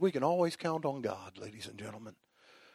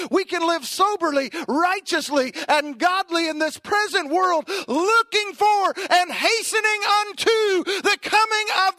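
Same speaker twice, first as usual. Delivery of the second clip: high, loud preaching swelling to a peak on the coming; building sermon climax; 10 semitones higher, 4 dB louder than usual. Acted. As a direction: lift the whole church with it.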